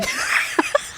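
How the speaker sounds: high breathless wheeze-laugh; dying wheezing cackle. Real, not acted. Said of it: "old man wheezing, dying laughs".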